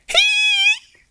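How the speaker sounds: tiny squeal, very high; a giddy high-pitched yelp of joy; excited squeal. Acted. Jack thrilled D J is coming along, squeals high. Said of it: "all excited".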